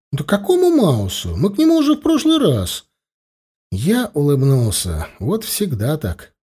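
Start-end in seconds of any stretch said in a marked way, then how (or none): 0.1-2.9 put-on high, indignant kid's voice; protesting child. Child rejects sibling's choice, protesting in a high voice. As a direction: object like a child arguing with a sibling.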